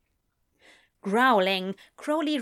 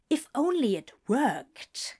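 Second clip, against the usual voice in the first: ordinary delivery, nothing marked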